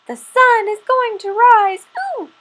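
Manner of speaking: high piping little squirrel voice. Acted. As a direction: pipe up like a small eager squirrel. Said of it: "Little Brown Squirrel piped up".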